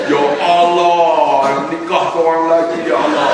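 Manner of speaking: milk it as a cheeky husband's plea; mock prayer. Husband joking he prays for another wife, slow mock plea.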